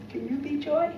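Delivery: ordinary delivery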